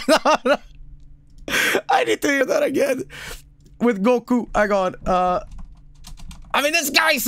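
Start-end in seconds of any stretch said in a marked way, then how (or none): 0.0-3.0 high, fast, laughing through tears; squeaky cry-laugh plea; teary laughter. Real, not acted. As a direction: beg for a replay while crying with laughter. Laughing-crying begging for a replay.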